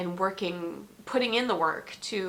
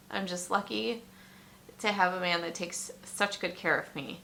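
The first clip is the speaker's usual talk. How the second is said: usual speaking voice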